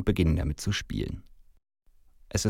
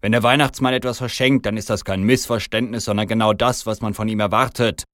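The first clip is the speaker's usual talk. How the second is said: loud, raised, affronted Santa; indignant protest; 3 semitones higher, 6 dB louder than usual. Acted. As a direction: argue back indignantly. Rufe ich empört.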